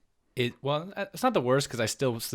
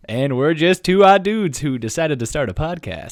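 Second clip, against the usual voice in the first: loud, slow hyped sports announcer; fighting game announcer; 3 semitones higher, 9 dB louder, slower than usual. Acted. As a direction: boom it out like a fight intro. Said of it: "you went from radio to a sports announcer".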